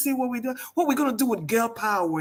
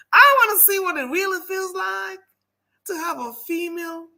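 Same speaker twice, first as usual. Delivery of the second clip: put-on Southern accent; 9 semitones higher than usual. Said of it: "I'm a southern belle".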